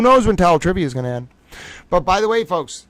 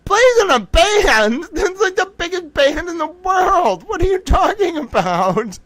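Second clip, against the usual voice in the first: high, loud, stretched-out disbelief; incredulous disbelief; 3 dB louder than usual. Real, not acted. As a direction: squeak it out like you can't believe it. Mock disbelief at famous band.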